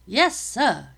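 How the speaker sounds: loud, high eager reply; enthusiastic response. Acted. Soldiers answering the captain, enthusiastic and loud. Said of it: "came the enthusiastic response".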